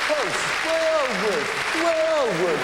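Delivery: high, loud mock chorus of agreement; mock crowd.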